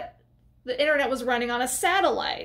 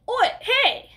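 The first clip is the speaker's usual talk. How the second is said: high, sharp mock call; imitated yell; 12 semitones higher, 4 dB louder than usual. Girl spots Maya on the scaffolding, mock yell. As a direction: call out like spotting someone escaping. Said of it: "the girl kinda yells out".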